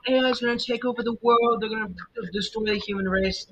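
mock the panicking doom crowd; doomsayer voice. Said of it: "some people say".

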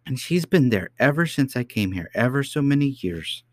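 high, lilting little princess; child princess, earnest. A narrator's reading. Princess telling doubting nurse about the lady, earnest. Princess continues her story.

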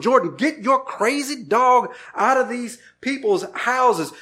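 lightly acted frantic shout at the friend; retold yell at a friend. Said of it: "I'm yelling at Jordan".

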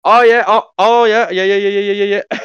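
high, loud, hurried yeah-yeahs; mocking Dan. Imitating Dan brushing off a talkative customer, high and loud. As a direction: gabble yeah over and over, brushing him off. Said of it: "and then Dan".

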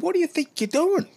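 high, loud panicked squeal; hammed-up shrill outrage; squeaky outraged driver. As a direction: mimic the driver freaking out. Driver yelling at him.

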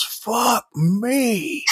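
high-pitched yell without extra volume; imitated yell. Retelling the hero seeing the bomb, high mock yell. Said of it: "he just yells".

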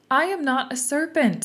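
storybook indignation; indignant. A narrator's reading. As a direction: protest firmly, pitch lifted. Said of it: "said Alice indignantly".

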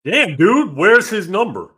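loud, high exasperated burst; loud real frustration; frustrated outburst. Real, not acted. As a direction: blurt it out in real annoyance. Frustrated, hunting for a contact.

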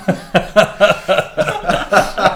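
long high burst of laughter; loud high laughter. Real, not acted. Host laughs at Hamudi's Gandhi nickname, loud and long.

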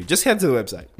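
rattled off in one quick run; hurried plug for the website; quick throwaway. Real, not acted. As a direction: toss the instruction off quickly. Same line redone after "enough.

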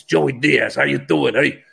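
a lightly done Jersey tough-guy voice; hint of a New Jersey wiseguy; Joey Diaz impression. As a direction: nod at a buddy's gruff greeting without going all the way. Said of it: "He talks like this".

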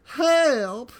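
high, loud cry for help. Retold or imitated. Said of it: "And we get SpongeBob".

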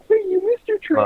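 very high sing-song mock voice; high teasing imitation of the date. Voicing the date waiting outside.

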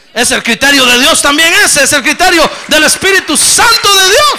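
loud, high shouted praise; full-throated pulpit cry; preaching at full force. Acted. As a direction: lift it up like a revival preacher.

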